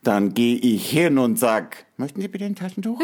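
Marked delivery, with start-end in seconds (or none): 2.0-3.0 mock polite. Mock polite offer to sniffer.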